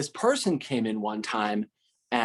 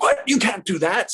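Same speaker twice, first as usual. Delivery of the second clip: mock outrage; 9 semitones higher, 5 dB louder than usual. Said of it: "And he's like".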